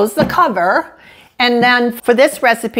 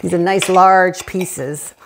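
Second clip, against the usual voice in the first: mouth full; 2 semitones lower than usual. Real, not acted. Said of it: "Let's try a piece of toasted".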